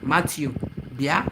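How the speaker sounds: ordinary talk, nothing marked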